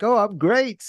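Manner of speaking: mock-chipper reply to a neighbor; fake cheerful. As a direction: paste on a big fake smile. His own fake-cheery reply.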